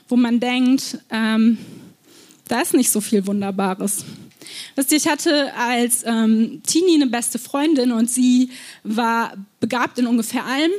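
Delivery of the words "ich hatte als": ordinary delivery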